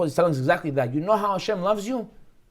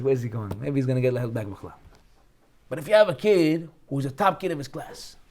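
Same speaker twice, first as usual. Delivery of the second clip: normal talking voice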